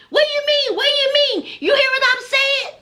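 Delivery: high-pitched, combative mock challenge; imitated fussing. Girlfriend arguing back at the men in the car, imitated fussing. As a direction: fire back repeated demands, imitating an argument. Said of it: "She all up in the car fussing".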